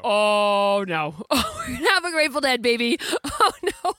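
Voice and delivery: high, laughing "oh no" of dismay; half-laugh the horror of it; laughing mock panic. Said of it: "we wake up and we're like".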